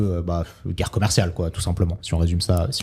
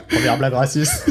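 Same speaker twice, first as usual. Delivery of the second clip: laughing; 7 semitones higher than usual. Real, not acted. Laughing at the joke.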